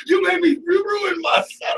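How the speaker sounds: high-pitched hammed-up wail; playful outraged yelp, way up high; mock tantrum. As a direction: shout it like a whiny mock meltdown. Mock tantrum over spoiled bit.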